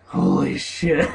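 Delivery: loud, drawn-out holy shit; shocked outburst. Real, not acted. Gamer startled mid-reading, loud outburst. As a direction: blurt it out in real shock.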